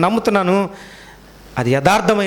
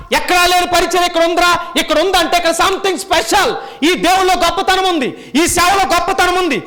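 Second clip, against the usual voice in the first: high, loud preaching voice; 13 semitones higher, 3 dB louder than usual. Acted. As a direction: preach with building fervour. Preaching at full force.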